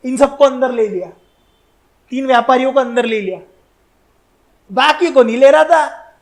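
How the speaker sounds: ordinary talk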